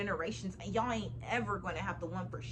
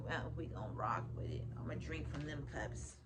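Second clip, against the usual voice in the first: whisper; 7 semitones lower, 8 dB quieter than usual. Real, not acted. Whispered aside to viewers.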